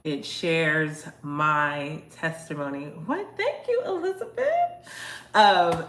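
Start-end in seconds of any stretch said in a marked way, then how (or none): 3.1-4.5 very high squealed thank-you; delighted thanks. Real, not acted. Livestreamer thanking a viewer mid-sentence, delighted high squeal.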